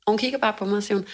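ordinary talk, nothing marked

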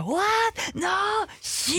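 high, gasping disbelief at each plot twist; shocked viewer. Retold or imitated. Fan reacting to soap twists, in high shocked gasps. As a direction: play a viewer hooked on the drama. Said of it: "Jag sitter där och bara".

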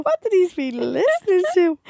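high, slow scandalised mother; shocked mom. Retold or imitated. Mom at a [name] concert, scandalised and slow. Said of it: "I'd say my mom was like".

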